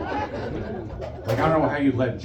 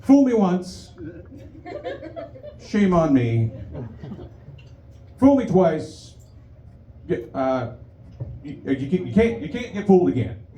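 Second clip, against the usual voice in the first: Bush impression; 7 semitones higher than usual. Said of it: "he would say things like".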